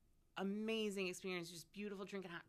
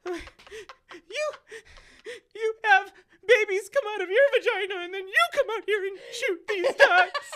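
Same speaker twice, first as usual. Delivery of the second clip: hammed-up wail, high and loud; mock-sob it at full volume; high, loud tearful protest, played for laughs; retold crying; 13 semitones higher, 15 dB louder than usual. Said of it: "I just start crying".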